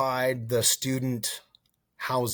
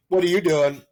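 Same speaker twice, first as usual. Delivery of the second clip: pitched-up, louder mock recruiter question; recruiter asking; 8 semitones higher, 7 dB louder than usual. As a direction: toss it at the buddy's friend, high and loud. Recruiter voiced in retold story.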